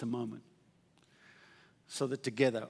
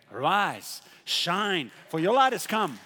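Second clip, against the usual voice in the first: raised, driving preacher voice; full-force preaching; 5 semitones higher, 5 dB louder than usual. Real, not acted. Preacher urges the church to arise and shine, forceful preaching. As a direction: proclaim it to the congregation with urgency.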